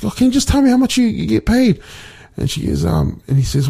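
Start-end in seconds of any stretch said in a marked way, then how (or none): 0.0-1.8 put-on high, rushed child's plea; little girl. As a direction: imitate a girl pestering her dad, pitched up.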